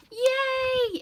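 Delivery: high, loud cheer; everybody cheering the carrot out; joyful yay. Acted. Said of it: "everybody screamed".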